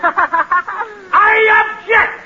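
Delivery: high, long-drawn-out courtroom protest; hammed-up outraged objection; shouted objection. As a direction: burst out, stretching each word. Defendant bursts out objecting in court.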